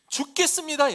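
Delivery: loud, high-pitched desperate plea; panicked disciples' cry. Acted. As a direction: call out as if about to perish. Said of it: "이야기를 하는". (죽을 듯 다급하게 외치는 소리)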